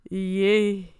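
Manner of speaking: ordinary delivery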